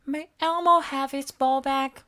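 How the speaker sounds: small eager monster voice; Elmo voice. A narrator's reading. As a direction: squeak it up like Elmo. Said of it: "Elmo asked".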